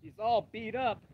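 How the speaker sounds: normal talking voice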